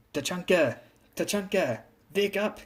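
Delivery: put-on high German-accented female figure calling out; heavy German accent. A narrator's reading. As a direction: call to wake him in a German accent. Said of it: "she said with a heavy German accent".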